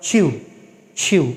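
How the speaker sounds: চিউ with a ch-like aspirated onset; correct pronunciation. Shown as a demonstration. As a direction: start চিউ with a puff, nearly ch. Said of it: "they are always pronouncing like". (চিউ, হাওয়া মেশানো শুরু)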